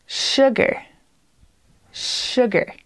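"Sugar" begins with a long sh hiss; sh sound in sugar. Shown as a demonstration. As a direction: stretch the sh in "Sugar", nearly breathed. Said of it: "It sounds like S H, sh".

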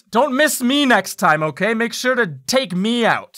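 taunting imp. Acted. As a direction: goad them loudly as a cheeky imp.

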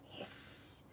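word drawn into a cat's hiss. A narrator's reading. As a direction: stretch the final s like a cat.

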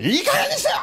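very high mock shout as the angry girlfriend; shrill woman's yell. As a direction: scream it like a furious woman. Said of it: "女の人がもう". (怒鳴る女性の物真似)

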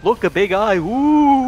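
mock excitement. Mock distraction.

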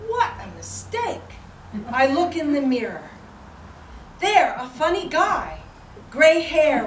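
poetry reading. Acted. Reading a late friend's title poem to tonight's audience, measured poetry recital. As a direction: declaim line by line, with pauses between phrases.

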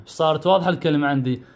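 normal talking voice

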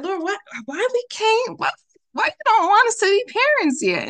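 high, loud pleading cry to God; desperate plea. Retold or imitated. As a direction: beg the Lord with a breaking high voice.

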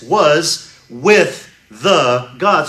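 "was with the God" drawn out, "with" stretched; slow it right down on "with"; slow emphatic quote. Shown as a demonstration. Preacher rebutting heretics, slow stressed reading. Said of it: "it's preceded by the imperfect tense".

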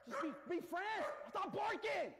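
very high, strained plea; high-pitched frustrated pleading; exasperated pleading. Real, not acted. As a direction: beg the pets in a high squeal. Pleading with barking, hissing pets.

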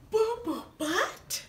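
soft, slow disbelief; incredulous. Acted. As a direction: repeat it in disbelief. Incredulous repeat.

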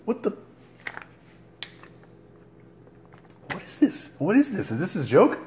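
high squeaky disbelief at the taste; shocked squeal. Real, not acted. Tasting another bad flavor, squealing in high disbelief.

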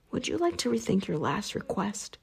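ordinary delivery, nothing marked

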